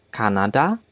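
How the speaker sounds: "Kanada" in German, open 'ah' vowels, rising end; German-style "Kanada", lifted at the end; German pronunciation. Shown as a demonstration. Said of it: "In German, we say Kanada".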